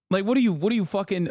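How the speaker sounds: ordinary delivery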